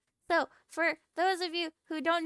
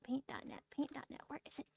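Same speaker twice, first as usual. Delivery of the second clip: whispering; 14 dB quieter, faster than usual. Real, not acted. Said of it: "muttering the app name".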